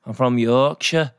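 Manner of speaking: slow, drawn-out Yorkshire accent. Shown as a demonstration. Podcaster mimicking how slowly Yorkshire people talk, Yorkshire accent. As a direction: imitate a slow Yorkshire speaker. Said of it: "Yorkshire in England is like".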